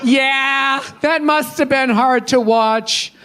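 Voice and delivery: high, slow, syrupy-sweet fake concern; mock liberal check-in. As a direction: lay the performative sympathy on thick.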